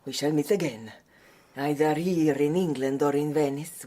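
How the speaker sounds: ordinary talk, nothing marked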